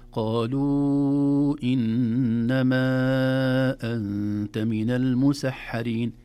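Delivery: loud, slow, sustained Quran recitation; تلاوة بالمد. Shown as a demonstration. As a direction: chant the verse with long held vowels. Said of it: "لاحظوا علامة المد". (تلاوة مرتلة بمدود طويلة)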